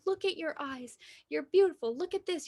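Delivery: lightly voiced reassuring mother; tender mother. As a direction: lift her up like a loving mom. Mom comforting crying daughter.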